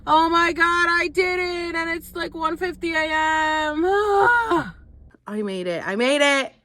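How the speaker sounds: high, loud squeals of relief; real overjoyed shouting. Real, not acted. Telling viewers she finally reached Las Vegas, elated shouting. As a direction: shout it out, voice soaring with excitement.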